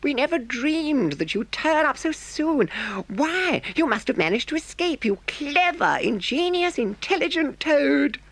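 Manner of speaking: high, gushing Mole voice; a mole heaping praise on Toad; delighted Mole. A narrator's reading. Mole praises clever Toad, gushing and high.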